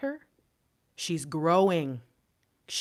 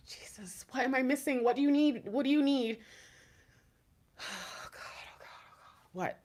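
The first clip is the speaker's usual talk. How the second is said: tearful pleading; 5 semitones higher, 7 dB quieter than usual. Real, not acted. Tearful pleading to herself, overwhelmed.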